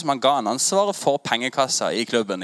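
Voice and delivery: ordinary delivery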